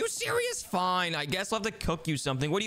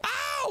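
high, sudden yelp of pain; sharp ow. Acted. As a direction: yelp as the snowball hits. Hit by a snowball.

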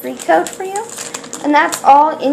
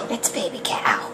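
softer, hushed whisper; awed whisper; 6 semitones lower, 10 dB quieter than usual. Real, not acted. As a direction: gasp then hushed whisper. Whispering over cute cow.

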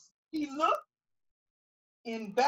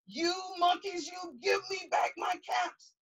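mock angry shout at the monkeys; 5 semitones higher than usual. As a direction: scold the monkeys, lightly raised. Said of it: "This made the peddler angry".

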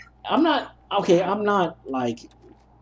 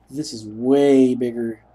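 ordinary talk

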